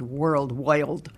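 put-on "world wild" in a light New York accent, the d of wide softened to an l sound; a historian imitating Al Smith saying "world wild" with a New York accent; New York pronunciation. New York accent, lightly imitated. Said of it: "says the word, the word".

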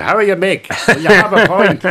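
loud, high strong Dublin uncle voice; strong Dublin accent. Retold or imitated. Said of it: "I did a strong Dublin accent".

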